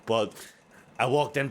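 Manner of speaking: ordinary talk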